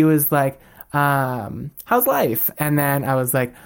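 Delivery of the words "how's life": light, high-pitched imitated question; chirpy small talk. Mom making small talk with child, chirpy imitation. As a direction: lilt it up casually, imitating. Retelling mom's casual opener.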